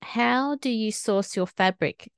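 ordinary talk

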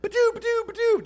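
high, mock pistol fire; cartoon gunfire mouth noises; gunshot noises. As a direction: spit out the shots like toy guns. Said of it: "They're like".

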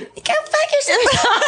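squeaky horn voice. Retold or imitated. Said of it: "it totally sounds like".

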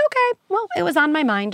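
put-on lighthearted high-pitched brush-off to a child; breezy high reply. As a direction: shrug it off lightly, voice way up. Casual parent reply to kid.

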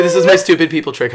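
usual speaking voice

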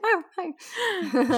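high, soft cooing hello; pet voice. Real, not acted. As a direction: coo softly at the pet. Greeting the pet.

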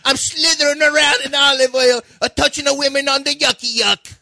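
very high, hammed-up Italian voice; mock Italian accent. Radio host clowning as an olive-oiled Italian, mock Italian accent. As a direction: play a cartoon Italian, squealing and silly. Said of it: "Look at me, Italian".

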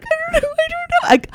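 very high, soft mock-crying squeak; imitating tearful speech. Mom answering V while crying, mock tearful. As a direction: squeak it out as if too choked up to talk. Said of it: "I couldn't even talk".

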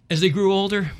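ordinary talk, nothing marked

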